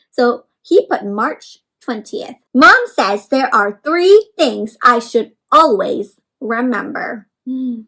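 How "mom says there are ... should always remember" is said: story reading. Acted. Reading the book's text aloud.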